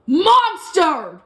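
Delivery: loud accusing yell. Acted. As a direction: hurl the word at her. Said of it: "yelled the Duke".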